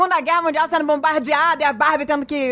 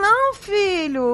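mock plea; 7 semitones higher, much slower than usual. Mother retells pleading refusal.

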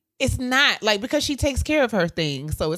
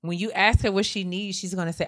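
ordinary talk, nothing marked

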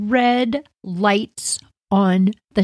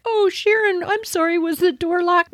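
high, sugary mock apology; fake innocent; 11 semitones higher than usual. Bratty teens faking surprise at the locked door, sugary. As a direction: play dumb sweetly. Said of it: "we're like".

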